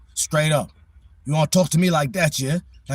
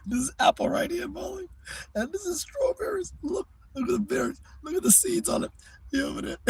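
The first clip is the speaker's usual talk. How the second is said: high, cooing voice showing the cat fruit; pet baby talk; 14 semitones higher, 6 dB quieter than usual. Acted. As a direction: coo at Molly like a toddler.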